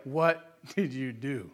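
slow, low and soft, coaxing a confession; gentle mom question. Retold or imitated. Mother gently asks crying son what he did, softly. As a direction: ease it out gently, like a worried mother. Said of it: "And she's like".

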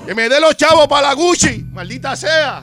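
mock demanding diva. Mocking a demanding partner.

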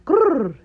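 high playful grrr; playful growl. Pretend. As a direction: growl like a sassy cat. Playful growl after affirmation.

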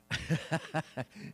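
soft acted chuckle, ha-ha-ha; imitated laughing. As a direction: chuckle knowingly, in character. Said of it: "He started laughing some more".

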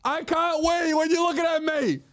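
put-on very high-pitched whining toddler; toddler tantrum. Patient treated like a toddler, bratty high whine. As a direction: wail it like a stubborn little kid. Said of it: "he's treating me like I'm a toddler".

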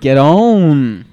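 sudden loud yell; loud shout. Real, not acted. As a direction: blurt it out at full volume. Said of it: "That was loud".

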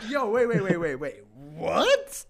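loud, high-pitched mock disbelief; retold incredulity. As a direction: re-enact being stunned, half laughing. Re-enacts his disbelief at her argument.

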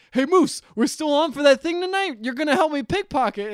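high, loud imitation of a pushy schemer; animated, acted-out badgering; pushy accosting. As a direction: call out and press him to join in. Acting out Todd accosting Moose.

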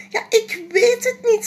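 put-on a small girl who doesn't know; little girl voice. Janneke answering papa, high little girl voice.

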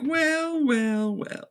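high start sinking to a hush; drawn-out smug fade; hammed-up villain gloat; mock smug well well well. Chucky smug at reveal.

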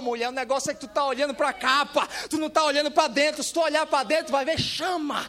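building to a loud, high shout; fired-up preacher addressing a woman; impassioned preaching. Acted. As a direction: climb toward a shouted climax on the last words.

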